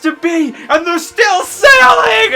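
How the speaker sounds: frustrated shout. Retold or imitated. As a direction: yell it at the ship that won't stop. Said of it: "visibly frustrated and goes".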